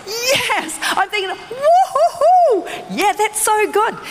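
high stretched woo-hoo cheer; gleeful whoop. Retold or imitated. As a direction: celebrate like you hit the jackpot. Investment went up.

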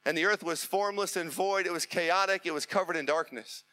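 ordinary delivery

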